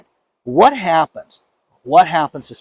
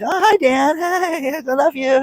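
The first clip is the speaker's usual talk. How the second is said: much higher, gushing mock fan voice; gushing fan woman; 11 semitones higher, slower than usual. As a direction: squeal it like an excited admirer. Bank woman greets fan.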